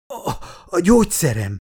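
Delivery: high, halting groan. A narrator's reading. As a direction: struggle to get the words out, groaning. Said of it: "nyögte a miniszter".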